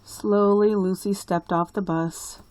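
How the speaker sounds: plain reading voice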